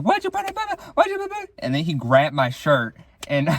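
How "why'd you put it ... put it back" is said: mock yell. Boy chasing the narrator over the ball, mock high angry yell. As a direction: squeal the question at him like an angry kid. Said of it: "yelling, screaming at me like".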